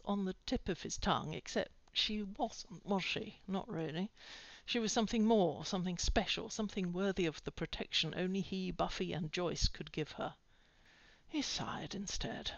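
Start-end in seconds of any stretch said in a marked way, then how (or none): none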